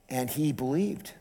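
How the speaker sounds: normal talking voice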